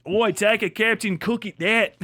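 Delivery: hammed-up Aussie slang; exaggerated Australian impression; mock Australian accent. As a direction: call out like a caricature Australian. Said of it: "Isn't that what you people say?".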